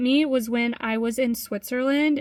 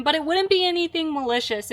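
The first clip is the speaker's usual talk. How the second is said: ordinary talk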